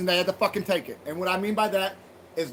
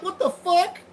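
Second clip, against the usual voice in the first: high, loud what the fuck; raised incredulous outburst; shocked disbelief; 9 semitones higher, 4 dB louder, slower than usual. Retold or imitated. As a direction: react in outraged disbelief. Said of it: "I'm like".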